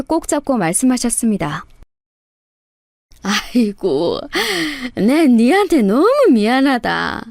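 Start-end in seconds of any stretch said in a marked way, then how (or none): 3.2-7.2 put-on lamenting, weepy old mother; tearful apology. A narrator's reading. Mother-in-law in hospital confessing to daughter-in-law, tearful. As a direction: plead forgiveness in acted tears. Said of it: "하염없이 눈물을 흘리시면서".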